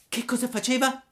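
put-on high-pitched child puppet voice; anxious puppet question. A narrator's reading. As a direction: ask eagerly in a squeaky puppet voice. Puppet asks after his father.

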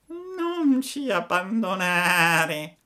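high, slow tearful begging whine; sobbing fox. A narrator's reading. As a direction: wail it like a fox faking tears. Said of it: "con voce di piagnisteo".

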